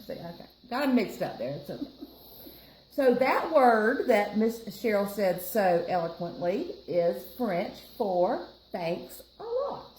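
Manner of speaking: ordinary delivery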